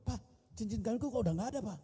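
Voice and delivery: high, quick mock alarm; startled wife. Wife finds her own ring missing, startled high voice. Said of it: "istri saya kaget".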